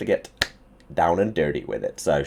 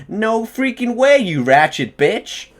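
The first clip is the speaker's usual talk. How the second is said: high, slow put-on girlfriend voice; a hammed-up high imitation of his girlfriend; poor Kirsty impression; 14 semitones higher, 8 dB louder, much slower than usual. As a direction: drag it out high and sassy like a bad impression of her. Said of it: "that was probably a really poor accent for Kirsty".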